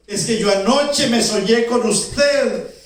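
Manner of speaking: high, loud, stretched-out eager explanation; excited. Acted. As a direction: tell her your dream with great excitement. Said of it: "Estaba tan contenta, tan emocionada". (explicación emocionada, alta, fuerte y pausada)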